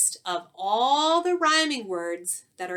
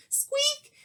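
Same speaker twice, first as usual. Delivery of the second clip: mouse squeak. Acted. Mouse squeaking nervously before the lion, tiny and high. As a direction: let a little squeak slip out.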